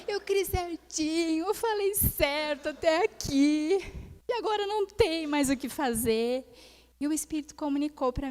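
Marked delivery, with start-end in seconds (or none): 0.1-6.5 slow, stretched mock-tearful lament; hammed-up weepy complaint; imitated crying. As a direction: whine it out like a retold sob. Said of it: "E eu chorando".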